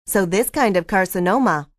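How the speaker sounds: usual speaking voice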